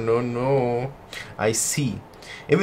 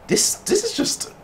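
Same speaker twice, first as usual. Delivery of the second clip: pitch-jumping disbelief. Real, not acted. Gamer reacting to a dark twist, voice jumping high. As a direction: voice leaps way up in disbelief. Reacting to the story getting worse.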